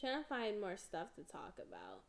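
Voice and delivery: ordinary talk, nothing marked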